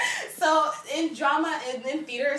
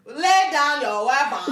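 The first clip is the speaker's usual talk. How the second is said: slow, breathy African accent imitation; imitating Chadwick's accent; 2 semitones higher, 6 dB louder, slower than usual. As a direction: drag out each syllable of weapon slowly. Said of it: "Chadwick was like".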